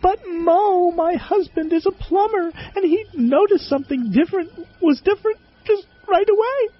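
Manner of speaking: put-on high, timid young woman; small shy voice. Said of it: "piped up in a small, shy voice".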